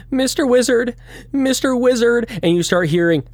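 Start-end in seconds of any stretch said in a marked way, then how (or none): 0.1-2.3 put-on high child ghost calling; little ghost Jimmy. Ghost boy calling the wizard in a high voice. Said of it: "as little Jimmy goes".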